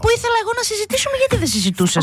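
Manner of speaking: high, fast protest; mock argument shooting up in pitch; losing composure. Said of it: "αλλά όχι αν φωνάζουμε ένας τον άλλον". (χάνει την ψυχραιμία, ψηλά και γρήγορα)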